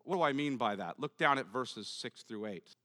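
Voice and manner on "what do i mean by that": ordinary talk, nothing marked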